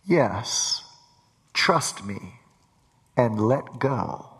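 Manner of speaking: deep, slow, calm voice from above; voice of God. Acted. God tells the man to let go, deep and slow. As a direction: speak down from heaven, low and measured. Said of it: "he hears the voice say".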